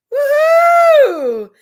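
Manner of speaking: high, loud whoop; cheering woohoo. Real, not acted. Streamer celebrating gifted memberships, loud high whoop. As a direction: let out a big celebratory whoop.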